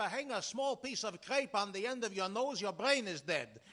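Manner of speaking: lightly voiced flustered caller with Yiddish-tinged accent; comic immigrant on the phone. Tenant calls the bank about his shutter, growing exasperated. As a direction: play the harried tenant shouting into the phone. Performs the Cohen telephone sketch.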